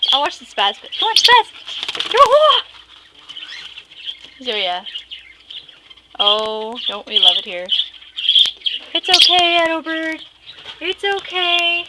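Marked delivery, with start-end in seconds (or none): 1.0-2.6 very high, loud and drawn-out call; excited egging on. Real, not acted. The owner egging the bird on to spaz, very high and loud. 8.9-11.8 higher, very slow cooing reassurance. Real, not acted. Comforting the little Edo bird, slow and high.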